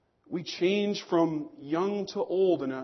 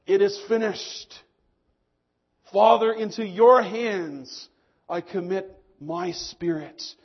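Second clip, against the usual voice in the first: louder, weighty last words called out; crying out; 8 dB louder, slower than usual. Retold or imitated. Preacher voices Jesus's last words on the cross, loud cry. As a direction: call it out as the final cry from the cross. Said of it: "and cried out".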